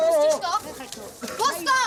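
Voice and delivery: fighting over the pram, shouting; angry shout. Real, not acted. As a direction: yell at her to drop it. Tug of war over a pram.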